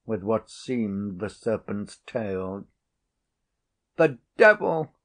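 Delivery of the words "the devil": shrill startled scream. A narrator's reading. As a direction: scream it in sudden terror. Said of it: "screamed the pious old lady".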